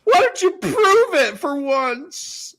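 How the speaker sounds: high, slow, sneering challenge; mocking taunt. A heckler taunting the captain, high and slow. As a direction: jeer at him in a put-on voice.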